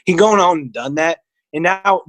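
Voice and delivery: normal talking voice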